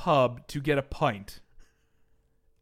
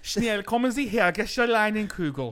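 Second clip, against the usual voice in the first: mock German accent; 4 semitones higher than usual. Mock German beer hawker.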